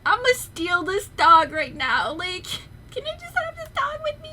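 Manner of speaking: rising high and excited, ending in a squeal; gushing over dog. Retold or imitated. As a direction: squeal like you can't resist the dog. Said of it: "I was like".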